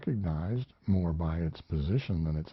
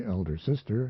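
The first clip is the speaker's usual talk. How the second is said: usual speaking voice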